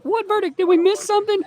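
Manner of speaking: very high, baffled squeak; confused crew. Pretend. News crew asking streamer, very high confused voice. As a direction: sound lost and panicked. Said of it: "They'd be like".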